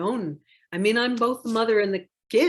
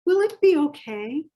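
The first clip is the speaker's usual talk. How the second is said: put-on much higher, louder little child's worried question; anxious child asking; 9 semitones higher, 8 dB louder than usual. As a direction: ask it like a scared small child. Begins reading book to listeners.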